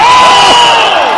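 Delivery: sudden high yell of shock; surprised yell. Real, not acted. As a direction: gasp it out loud. Big spot in the match.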